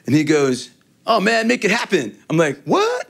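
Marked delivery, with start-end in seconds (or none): 1.1-2.2 slightly raised, bad impression voice; higher, lightly put-on quote. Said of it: "a bad impression of them doing it". 2.6-3.1 high, rising mock disbelief; very high acted double-take; retold amazement. Retold amazed reaction to the promise.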